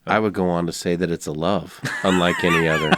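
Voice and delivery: low, smooth announcer voice; Jim Nantz. Acted. Joining the co-host's mock sermon as a golf announcer, low and smooth. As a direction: play a measured golf broadcaster, low. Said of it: "Masters Jim Nance voice".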